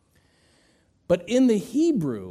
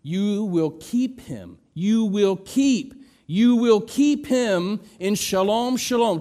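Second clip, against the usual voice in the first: slow, louder, higher; preaching at force; 5 semitones higher, 6 dB louder than usual. Acted. Preacher proclaiming the verse, slow and loud. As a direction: hammer keep each time.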